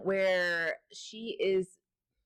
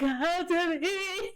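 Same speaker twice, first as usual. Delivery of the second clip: high, very slow eerie call, broken by laughter; possessed woman beckoning; 10 semitones higher, much slower than usual. Retold or imitated. Retelling the possessed wife calling from the bed, eerie and slow. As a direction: drag out each word like a possessed woman luring him in, giggling through it.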